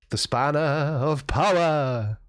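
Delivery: slow, grand announcement; mock grandiose. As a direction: present it like a royal honour. Mock grand presentation of spanner.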